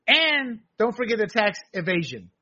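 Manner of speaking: usual speaking voice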